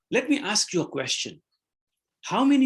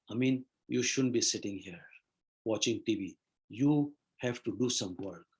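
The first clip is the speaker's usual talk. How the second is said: lower, softer scolding inner critic; nagging inner voice; 6 semitones lower, 5 dB quieter than usual. Retold or imitated. Teacher voicing a student's inner critic, low scolding murmur. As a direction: murmur it like a conscience nagging from inside. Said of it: "the voice over comes and says".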